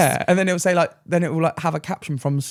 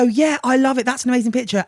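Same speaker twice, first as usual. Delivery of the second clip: fake gushing; 9 semitones higher, 3 dB louder than usual. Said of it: "I look at it and I go".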